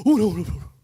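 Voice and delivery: high, loud, fast gasps of fright; startled cry. Acted. Disciples panicking at God's voice, frightened cry. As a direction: cry out in sudden terror.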